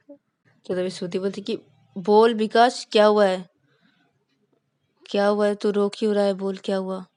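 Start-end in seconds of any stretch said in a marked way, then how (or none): 2.0-7.0 louder, pressing questions; insistent friend. Acted. Shruti pressing her crying friend for answers, loud and insistent. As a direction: demand he tell you what happened.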